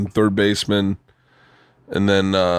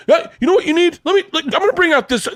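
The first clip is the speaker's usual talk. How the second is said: much higher, quick, eager salesman voice; imitating the fitter who can't wait to show him gear; hammed-up excited golf fitter; excited club fitter; faster than usual.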